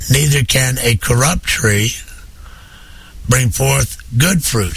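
normal talking voice

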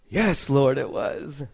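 lightly voiced meek confession; humble answer. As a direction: answer the Lord meekly. Congregation's answer to God.

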